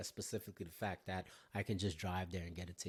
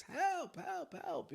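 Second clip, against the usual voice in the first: retold cry for help; much slower than usual. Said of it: "screaming top of my lungs".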